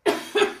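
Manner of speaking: breathy choking cough. Real, not acted. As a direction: cough to clear the throat. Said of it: "je m'étouffe". (toux sèche d'étouffement)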